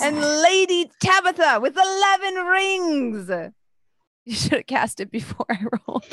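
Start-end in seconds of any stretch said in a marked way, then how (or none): 0.0-3.3 high announcer call. Acted. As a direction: proudly announce the winner. GM announces the winner.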